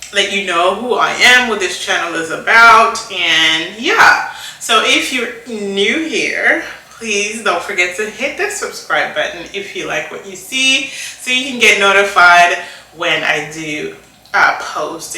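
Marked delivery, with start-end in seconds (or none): none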